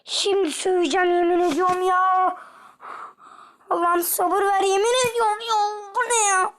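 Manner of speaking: high, loud, near tears; frustrated whine. Real, not acted. Close to tears at the game's unfairness. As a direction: whimper your frustration.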